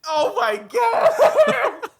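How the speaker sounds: squealing with delight; excited shriek. Real, not acted. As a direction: shriek of joy, laughing. Hears favourite voice actor announced.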